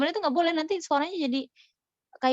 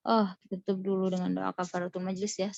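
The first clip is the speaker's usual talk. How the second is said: ordinary delivery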